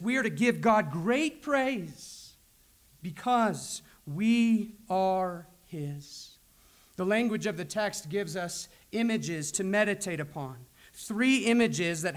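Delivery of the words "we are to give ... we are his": "great" and "we are his" weighted, the ending slowed right down; heavy emphasis. Shown as a demonstration. Preacher showing how God himself stresses it, slow and deliberate. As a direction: linger over "because we are his". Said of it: "You can hear God's own emphasis".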